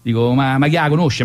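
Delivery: ordinary talk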